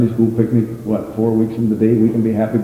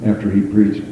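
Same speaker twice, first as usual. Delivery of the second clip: ordinary delivery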